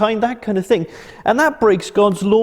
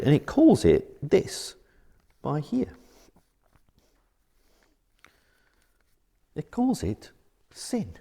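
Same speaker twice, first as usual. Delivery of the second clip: hushed reveal; 6 semitones lower, 7 dB quieter, slower than usual. Acted. Dramatic hushed reveal of word.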